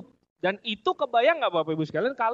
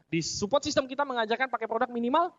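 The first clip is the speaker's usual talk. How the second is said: ordinary talk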